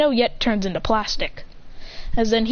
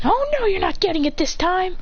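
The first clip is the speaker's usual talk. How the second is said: higher bossy sister voice; sister teasing; 8 semitones higher than usual. Acted. Serafina tells her brother he won't get it, higher teasing. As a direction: tease him about the cake.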